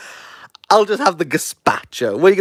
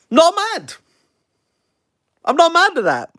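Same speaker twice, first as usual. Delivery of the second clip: excited; 11 semitones higher than usual. Real, not acted. Reacting happily to the winner.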